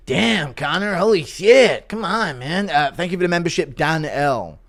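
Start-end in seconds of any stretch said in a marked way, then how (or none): 0.1-2.7 imitated fighter's astonished reaction; mock disbelief in a put-on voice; Bobby Green. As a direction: play a fighter reacting in shock. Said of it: "Impression of Bobby Green seeing Connor dick pics".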